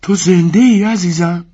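wife fearfully calling her swallowed husband; frightened wife asking if he is alive; trembling voice. Acted. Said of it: "با صدای لرزان گفت".

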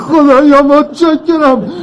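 high, drawn-out cry of thanks; overjoyed relief. Acted. Father thanking God for his found daughter, overjoyed. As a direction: cry out slowly in joyful relief.

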